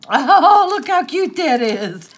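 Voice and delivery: loud, high burst of delight; delighted squeal. Real, not acted. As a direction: squeal over something adorable. Delighted reaction to a gift.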